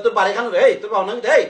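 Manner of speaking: normal talking voice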